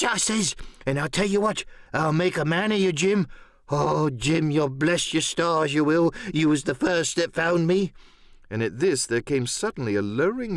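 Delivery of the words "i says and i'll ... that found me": lightly voiced eager castaway; a hint of a hermit making promises; Ben Gunn. A narrator's reading.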